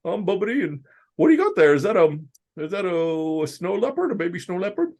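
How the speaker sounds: high buck-toothed puppet voice, curious; high squeaky puppet, marveling at a baby snow leopard; Bumpity voice. Retold or imitated. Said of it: "he would kinda talk like this".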